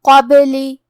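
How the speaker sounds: the ba in قبلی bounced with an echo; qalqala on ba. Shown as a demonstration. Teacher demonstrating qalqala on قبلی. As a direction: shake the ba in قبلی as it stops. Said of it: "حرف البا کو ہلاتے ہوئے".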